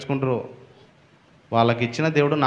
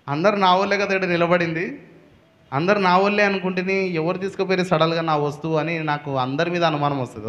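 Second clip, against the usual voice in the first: usual speaking voice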